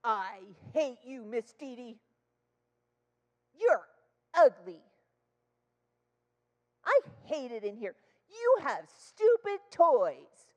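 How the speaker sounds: loud, drawn-out mock kid's rage; imitated child tantrum. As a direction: hurl insults like an angry little girl. Child's angry insults acted out.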